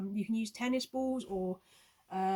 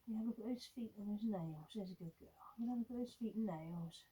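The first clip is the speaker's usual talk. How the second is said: near-whispered praise to the puppy; hushed, tender coaxing of the dog; gentle whisper; 9 dB quieter than usual. Shown as a demonstration. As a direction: breathe the words softly so she stays calm. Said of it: "get used to very gently saying".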